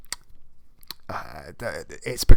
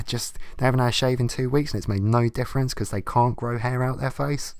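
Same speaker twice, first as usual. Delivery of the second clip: usual speaking voice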